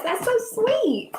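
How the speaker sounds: high, slow, touched coo. Real, not acted. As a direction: melt at a sweet comment. Touched by a viewer's comment.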